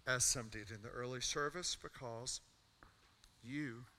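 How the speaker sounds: earnest pastoral invitation, building over music; altar call. Real, not acted. Pastor inviting the congregation forward, earnest and building. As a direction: call them forward gently, then rise.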